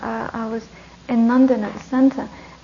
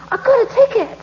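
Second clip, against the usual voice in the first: excited fan; 10 semitones higher, 4 dB louder than usual. Retold or imitated. Excited strangers quoted at checkout.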